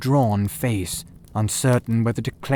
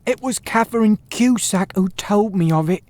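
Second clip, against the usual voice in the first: higher, cracking nervous voice; a frightened thief confessing to the detective, crackling; 9 semitones higher, 4 dB louder than usual. A narrator's reading. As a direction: let the voice crack with fear. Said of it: "said he in a crackling voice".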